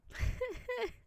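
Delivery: high laugh as tears break through; tearful laugh. Real, not acted. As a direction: let a laugh burst out through sudden tears. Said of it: "I don't know why I'm crying".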